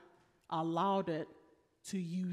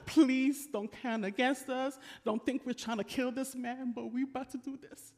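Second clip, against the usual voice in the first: laughing plea; 5 semitones higher than usual. Acted. Preacher retelling the sailors' prayer, laughing as he pleads. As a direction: beg while laughing.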